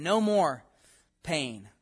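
usual speaking voice

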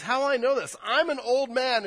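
I in "How will I know this" hit high and loud; the word I stressed in a loud doubting question. Retold or imitated. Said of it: "the I is emphasized there".

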